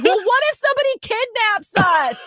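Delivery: high, loud mock panic; imitating loud scared girls. Girls fretting in a dark theater, mock panic. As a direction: cry out like a dramatic kid. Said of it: "They're just normal volume".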